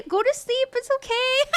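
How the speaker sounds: high-pitched, amused mock soothing; high voice between bursts of laughter; amused, laughing. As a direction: tell a tipsy brother off through giggles. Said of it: "I'm laughing about".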